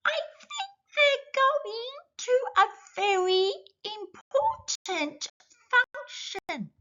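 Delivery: very high squeaky bug voice, slowing on important; a little ladybird sounding very grand, very high; the ladybird guesses the penguins' errand, very high squeaky voice; tiny ladybird voice. Acted.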